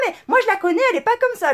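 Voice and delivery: voix nettement plus haute, imitée avec légèreté; défense imitée. Retold or imitated. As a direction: singer une collègue sûre d'elle. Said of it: "elle me dit, non". (la nouvelle collègue défend la CPE, imitée plus haut)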